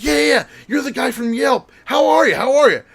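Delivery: high, loud, overjoyed greeting; gushing excitement. Retold or imitated. Stranger greeting the Yelp guy, high loud gushing. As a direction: gush at him like an old friend. Woman gushing over Jason.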